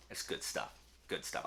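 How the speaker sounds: hushed, breathy whisper to himself; whispered aside. Real, not acted. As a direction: whisper it like a private verdict. Muttered praise to himself.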